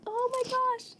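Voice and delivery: very high, soft mock squeak; hushed amazement. Host reacting to the singer's calm, hushed amazement. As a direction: gasp it high and small.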